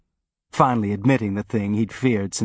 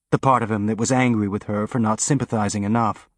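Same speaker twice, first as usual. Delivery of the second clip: usual speaking voice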